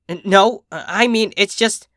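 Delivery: high, stammering denial; flustered stammer. Acted. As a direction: scramble to take it back.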